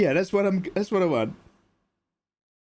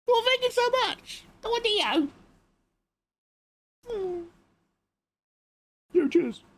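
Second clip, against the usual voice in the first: very high squeaky thanks; squeaky falsetto. Acted.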